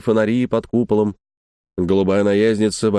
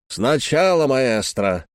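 usual speaking voice

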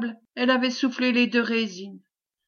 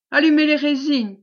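shouted command; 4 semitones higher, 6 dB louder than usual. A narrator's reading. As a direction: order called out high and loud. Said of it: "commanda Mélois". (ordre crié, haut et fort)

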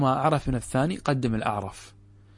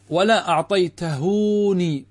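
ولا أعطيتهوني drawn out slowly, pitched up; slow wrong-form demo; 4 semitones higher, 3 dB louder, much slower than usual. Shown as a demonstration. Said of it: "هذا لا يجوز". (نطق بطيء للمثال الخاطئ)

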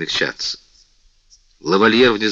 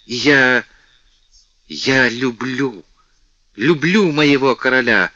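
passionate; 4 semitones higher, 4 dB louder, slower than usual. A narrator's reading. Climactic love confession.

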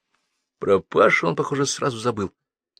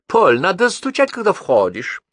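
a lightly voiced princess, pitched up; princess Vera's tone; 9 semitones higher, 5 dB louder than usual. A narrator's reading. Princess reminding Paul to knock, lightly affected reproof. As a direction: reprimand him as a princess would, high then settling. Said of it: "превратилась в княжну Веру".

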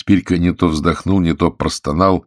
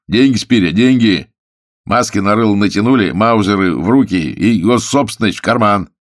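loud, higher, punchy charge; forceful accusation; 4 semitones higher, 5 dB louder than usual. Acted. Investigator breaks in accusing thief of robbery, forcefully. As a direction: hammer the accusation home.

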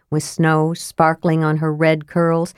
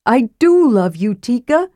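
desperate; 6 semitones higher, 3 dB louder than usual. A narrator's reading. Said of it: "said Karaman desperately".

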